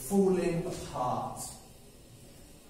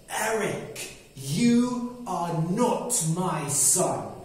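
father disowns Eric in a furious, raised voice; 3 semitones higher, 5 dB louder, slower than usual. Acted. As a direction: throw the son out in a rage. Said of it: "He was furious".

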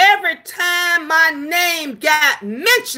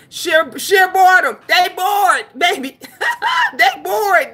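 laughing mockery; 5 semitones higher than usual. Real, not acted. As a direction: crack up while mocking them. Mocking trolls as bored.

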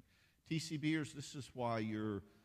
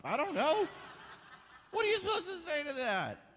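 high, loud comic disbelief; preacher asking the crowd, loud and baffled; exasperated bafflement; 8 dB louder than usual. Real, not acted. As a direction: throw up your hands at the crowd. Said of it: "pleading with audience".

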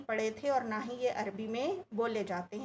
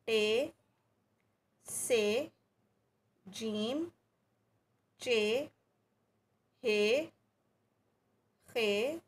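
each letter said alone, firm and a little louder, with long pauses; alphabet recitation for repeating; 3 dB louder, much slower than usual. Shown as a demonstration. Teacher reciting the Urdu alphabet to pupils, loud and deliberate. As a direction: call out each letter clearly so the children can echo it. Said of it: "आपने मेरे साथ साथ पढ़ना है".

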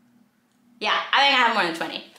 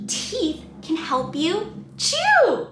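normal talking voice

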